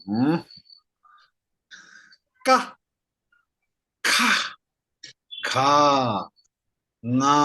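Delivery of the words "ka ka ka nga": Ka Ka Ka then Nga, each held long and sung out; drawn-out teaching chant of Ka and Nga; syllable drill. Shown as a demonstration. Teacher to class, drilling each sound slowly. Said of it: "I will read them one by one".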